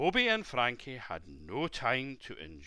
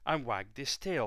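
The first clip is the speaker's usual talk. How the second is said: usual speaking voice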